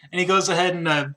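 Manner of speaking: ordinary talk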